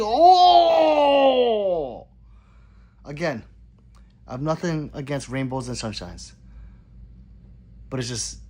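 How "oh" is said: long, loud, high "oh" falling away; mock wail. Acts out shocked reaction to song.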